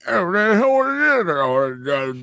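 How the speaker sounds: high, slow put-on Cockney; backwoods Cockney accent. Shown as a demonstration. Co-host showing the accent he wants on the police radio, slow high Cockney. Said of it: "the most backwoods Cockney".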